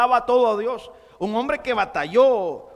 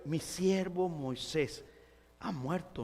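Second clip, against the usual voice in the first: slow and much softer, almost hushed; hushed voice of God; 3 semitones lower, 11 dB quieter, slower than usual. Pretend. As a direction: let God's words sink in softly. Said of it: "Imagínese el Señor diciéndole a Josué".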